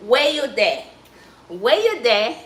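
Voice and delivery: Patois "Where you there?" with "there" as "de"; relaxed Jamaican Patois phrasing. Shown as a demonstration. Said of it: "we'll say in Patois".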